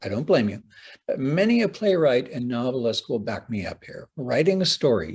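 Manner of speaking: ordinary talk, nothing marked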